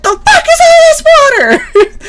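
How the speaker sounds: very high, loud shout through laughter; mock shout. Sergeant yelling from the bathroom, laughing mock shout. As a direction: yell it while cracking up. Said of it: "She's like".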